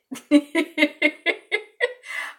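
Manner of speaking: high, rapid giggle. Real, not acted. Speaker amused by the roach names, high giggle. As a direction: giggle in a long string.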